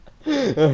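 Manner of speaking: loud high laugh; burst of laughter. Real, not acted. Reader laughs.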